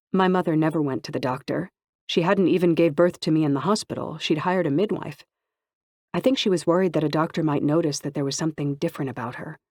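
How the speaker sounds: ordinary delivery, nothing marked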